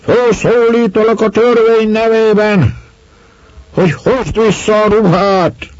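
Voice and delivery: high, loud policeman's command; shouted official order. A narrator's reading. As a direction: proclaim it at full voice in the name of the law. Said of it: "rikkantott a csendőr". (csendőr harsány felszólítása)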